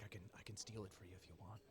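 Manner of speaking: soft, fast conspiratorial aside; sly whisper. Acted. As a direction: lean in and murmur it quickly. Belbin leans over, sneaky offer.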